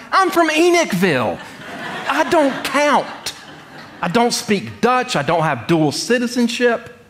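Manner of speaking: high, laughing, amused self-deprecation; laughing inner protest. Retold or imitated. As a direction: chuckle through it as if you don't count. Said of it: "in my head, I'm like".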